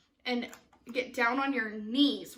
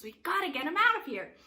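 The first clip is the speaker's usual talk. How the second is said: higher-pitched, pressing plea; urgent warning; 5 semitones higher, faster than usual. Shown as a demonstration. Cogsworth urges the group to come to their senses, urgent. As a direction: urge them to see sense. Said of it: "come to their senses".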